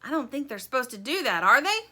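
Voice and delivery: bugle voice. A narrator's reading. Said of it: "the voice goes on".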